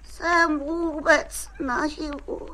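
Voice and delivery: slow, drawn-out groaning; feeble, suffering patient; pained moaning. Acted. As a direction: moan theatrically as if badly hurt. Injured patient groans to doctor.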